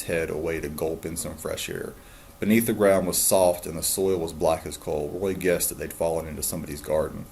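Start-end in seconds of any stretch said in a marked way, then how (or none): none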